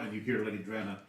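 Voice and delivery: ordinary delivery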